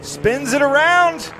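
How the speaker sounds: high, loud, slow exclamation as the car spins; excited call. Real, not acted. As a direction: call the spin with real alarm. Car spins during live race.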